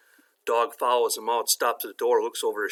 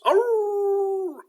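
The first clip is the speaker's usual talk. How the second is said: long, very high dog howl; loud drawn-out doggy yelp; talking dog; 6 dB louder than usual. Acted. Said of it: "And the dog goes".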